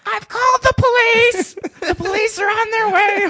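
very high, loud screeching yell; screechy housewife. Retold or imitated. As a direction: shriek it like an alarmed housewife far down the street. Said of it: "some, like, screechy housewife going".

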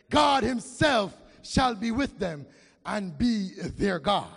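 usual speaking voice

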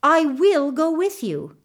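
determined, refusing to be put off; insistent. A narrator's reading. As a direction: insist on it, leaning on "will". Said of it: "the gardener's boy insisted".

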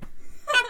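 a burst of squealing laughter; high squeaky laugh. Real, not acted. Cohost cracks up at the joke, squealing laugh. Comic laugh at host's line.

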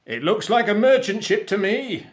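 high, loud lookout call; excited pirate captain calling out; captain's shout. A narrator's reading. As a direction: shout it across the deck. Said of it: "he shouted".

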